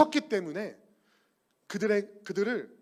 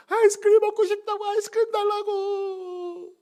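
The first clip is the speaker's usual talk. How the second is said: put-on very high, slow wailing child; crying tantrum; 6 dB louder than usual. Child wailing at mom for ice cream, crying. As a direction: sob it out like a tantrum. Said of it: "울기 시작하죠".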